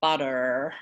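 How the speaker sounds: butter ending in an R-coloured er; butter with a rhotic American ending. Shown as a demonstration. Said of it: "R sound that goes with".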